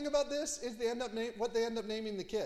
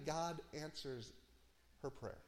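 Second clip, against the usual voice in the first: hushed reverent close; 6 semitones lower, 11 dB quieter than usual. Real, not acted. Preacher softly telling the congregation God answered. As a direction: drop to a near hush on her prayer. Climax of barren woman story.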